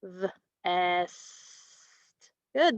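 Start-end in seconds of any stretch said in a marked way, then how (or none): 0.0-1.1 VEST split as v... esss-t; sounded out. Shown as a demonstration. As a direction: stretch VEST, pausing after the v. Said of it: "The new word is".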